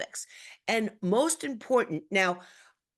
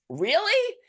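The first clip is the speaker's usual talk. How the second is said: very high, rising mock disbelief; sarcastic; 12 semitones higher, 4 dB louder than usual. Repeating the misread reply from the thread, sarcastic. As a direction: exaggerate the incredulous upswing. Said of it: "that was sarcastic".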